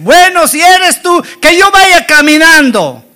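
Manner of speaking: high-pitched impulsive demand; rash bravado. Acted. Peter in his confusion makes a rash request of Jesus, impulsive.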